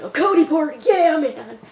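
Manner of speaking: high, loud party whoop; hyped-up party-guy cheer; excited cheer. Retold or imitated. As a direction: imitate thrilled buddies, pitch way up. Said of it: "So they're all excited".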